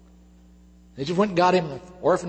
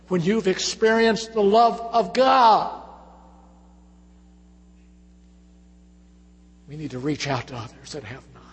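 preaching at full force; 5 semitones higher, 5 dB louder than usual. Acted. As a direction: drive each line home from the pulpit. Preaching to the congregation.

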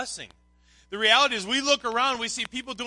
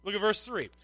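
ordinary delivery

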